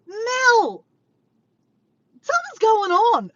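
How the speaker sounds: high, loud mock holler; mimicking someone calling out in alarm; imitated calling out. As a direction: retell it as a yell through the house. Retold husband calling out to Mel.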